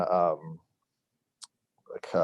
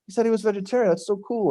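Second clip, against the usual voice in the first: high, excited fan gush; starstruck gushing; 12 semitones higher, faster than usual. Retold or imitated. Teen gushes to friends about the band, high and thrilled.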